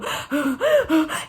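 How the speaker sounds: mock winded gasp for air. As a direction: gulp a breath before answering. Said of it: "And I would go".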